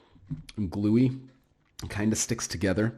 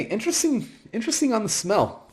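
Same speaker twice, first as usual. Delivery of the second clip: intrigued; 13 semitones higher than usual. Real, not acted. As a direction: sniff and sound curious. Sniffing the pastry, intrigued.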